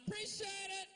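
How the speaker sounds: high, bright shout of thanks from a passerby; grateful stranger. Retold or imitated. Passerby shouting thanks at the dad.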